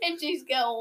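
ordinary talk, nothing marked

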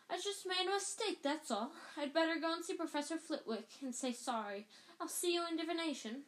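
hurried schoolgirl excuse; lightly voiced anxious girl; Hermione. Hermione voiced in read story.

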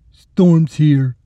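heavy orderly's voice, pushed out; thick voice. A narrator's reading. Brutal orderly taunting his captive in a thick voice. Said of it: "he said in his thick voice".

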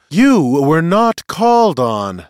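loud, high, slow rebuke; ringing voice. Acted. Seer cuts off the outburst, ringing command. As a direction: ring it out across a whole hall. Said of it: "in a voice ringing throughout the hall".

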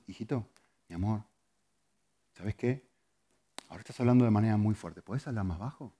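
soft, low, gentle parent; calm, affectionate correction to a child; patient, loving tone. Retold or imitated. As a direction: ask tenderly, without condemnation. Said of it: "pero se lo digo así".

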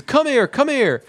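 high-pitched mock angry call; chasing Ross. Ross chasing Joey and Chandler, high mock anger. Angry father chases two friends.

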